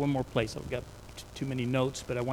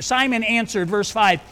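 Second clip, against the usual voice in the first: normal talking voice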